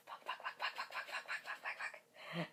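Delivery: whispered string of swears; frantic whispered panic; inner panic. Real, not acted. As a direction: panic silently under your breath. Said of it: "on the inside".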